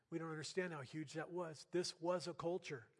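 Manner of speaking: ordinary talk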